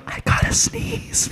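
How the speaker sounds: imitating a hushed, unvoiced whisper; drawn-out whisper, poking fun at it; mock whisper. Host teasing Will for whispering before a sneeze, mock whisper. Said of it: "Why did you whisper that".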